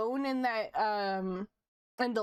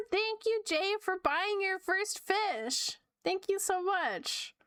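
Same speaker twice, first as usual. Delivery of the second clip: bright thanks; 7 semitones higher, slower than usual. Real, not acted. Thanking a viewer on stream.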